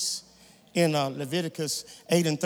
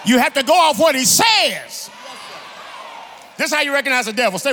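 loud, emphatic sermon build; preacher in full cry; full-force preaching; 6 semitones higher, 8 dB louder than usual. Acted. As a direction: drive each point out loud to the congregation.

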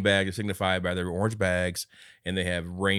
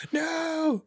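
anguished cry. Retold or imitated. Man reaching for dragged fiancee.

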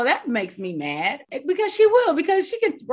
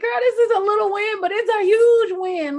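excited hype; 12 semitones higher, 5 dB louder than usual. Retold or imitated. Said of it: "And I was like".

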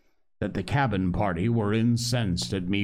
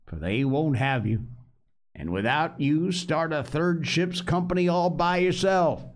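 pirate cook's voice, higher and cajoling; a rough old sea cook teasing the boy; Long John Silver; 6 semitones higher than usual. Acted. Old pirate teases captive boy, wheedling sea-cook voice.

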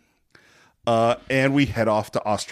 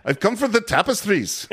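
high-pitched mock Scottish accent, amused; 8 semitones higher than usual. Quoting the disguised hero at the castle door, mock Scottish accent. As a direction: do a comic put-on Scottish lord's accent. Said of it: "it's a Scottish accent".

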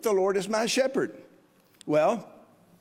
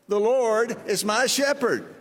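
slower, louder, each word laid out for the room to echo; slow recitation for the congregation to repeat; 4 semitones higher, 3 dB louder than usual. Shown as a demonstration. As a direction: recite it slowly so they can say it with you. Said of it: "Say that with me".

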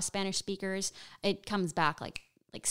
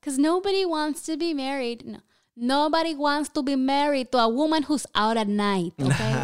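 loud, higher-pitched mock maternal lecture; scolding mother impression; 6 semitones higher, 7 dB louder than usual. Comic imitating mom on finding a husband, loud scolding. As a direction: lay down the law as an exasperated mom.

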